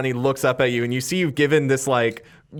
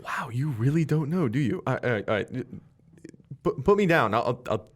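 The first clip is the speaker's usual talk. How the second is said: nervous Skid. Acted. GM plays Skid, nervous and placating.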